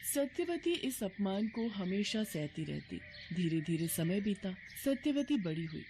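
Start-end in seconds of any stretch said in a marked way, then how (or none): none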